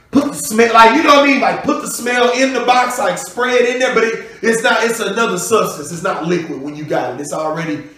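ordinary delivery

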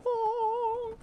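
long, very high held "oh"; high dreamy "oh". Retold or imitated. Mimics the skyward-staring painted Jesus.

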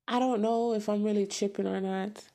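ordinary delivery

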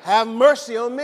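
loud, projected plea for mercy; big call-and-response cry; loud prayer cry. Shown as a demonstration. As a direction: belt it out to lead the congregation. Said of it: "say it as loud as we can".

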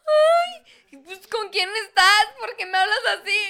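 high, loud, fast mock jealous whine; jealous girlfriend. Retelling how she answers her boyfriend, hammed-up jealous whine. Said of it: "Y lo yo".

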